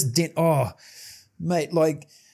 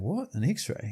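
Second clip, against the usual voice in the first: retold disbelief; 8 dB quieter, slower than usual. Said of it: "And I'm like going".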